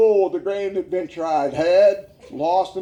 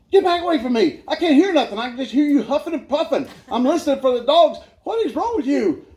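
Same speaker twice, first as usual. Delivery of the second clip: high-pitched imitation of an irritated partner; exasperated scolding; 6 semitones higher than usual. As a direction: scold someone crowding you, pitched up. Voices annoyed hunting partner's scolding.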